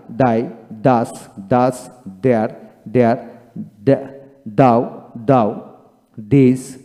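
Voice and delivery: teacher modelling th as দ, word by word; th pronounced as Bengali দ, repeated drill. Shown as a demonstration. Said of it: "এইভাবে উচ্চারণ করতে হবে".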